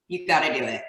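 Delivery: ordinary delivery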